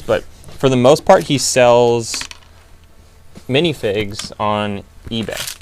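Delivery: usual speaking voice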